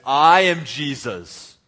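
booming, slow superhero-style proclamation; loud voice. Acted. Preacher imagining Jesus arriving at Bethany, loud proclamation. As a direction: announce yourself grandly, loud and drawn out. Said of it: "says in a loud voice".

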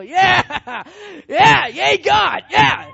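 loud, high triumphant cheering with laughter; whooping celebration. Acted. Elijah celebrates loudly, laughing, high and loud. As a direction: Elijah whooping for joy after his victory.

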